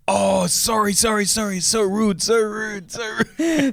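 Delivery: high-pitched, repetitive apologising; mock flustered apology. Uber driver apologising for being rude, mock flustered.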